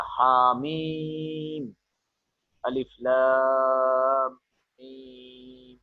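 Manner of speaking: "ha, mim, alif, lam, mim" with vowels held very long; four-alif long mad. Shown as a demonstration. Teacher showing how to recite long mad, "ha, mim, alif, lam, mim" drawn out. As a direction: chant "ha, mim, alif, lam, mim", sustaining "mim" and "lam" on a level note. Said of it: "You have to read like this".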